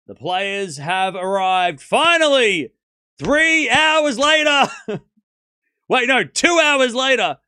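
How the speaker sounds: slow, loud, building to a high cry; mock grand announcer. Announcing a long-delayed match to chat, mock grandeur. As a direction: proclaim it with drawn-out exasperated pomp.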